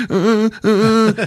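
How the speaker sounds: high-pitched mock sobs; fake crying. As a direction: whimper like a kid faking it. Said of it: "he's fucking fake crying".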